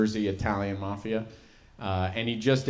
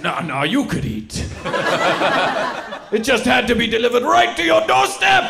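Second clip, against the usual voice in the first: higher shouted mock hardship; indignant grandpa; 9 semitones higher, 7 dB louder than usual. Grandpa correcting grandkid, indignant shouted. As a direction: bellow the delivery horror.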